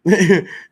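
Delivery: big burst of laughter; sudden loud laughing fit. Real, not acted. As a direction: laugh out loud, high and hard. Laughs at drowning story.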